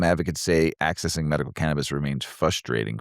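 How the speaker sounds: ordinary talk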